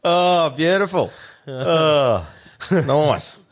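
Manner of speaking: amused. Real, not acted. Reacts to joke delighted.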